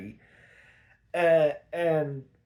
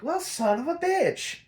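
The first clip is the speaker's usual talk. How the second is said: much higher, put-on Catwoman snark; 12 semitones higher than usual. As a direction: squeak it out like a cheated cat burglar. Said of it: "And Catwoman's like".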